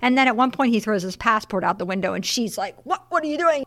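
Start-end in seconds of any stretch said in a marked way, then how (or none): 2.8-3.6 acting out alarmed disbelief, pitched up; mock panicked questioning, high; freaking out. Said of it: "She's freaking out".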